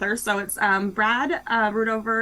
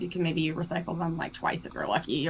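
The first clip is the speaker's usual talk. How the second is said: soft, half-whispered aside; 4 semitones lower, 6 dB quieter than usual. Real, not acted. Author confiding to the host about plastic, whispered.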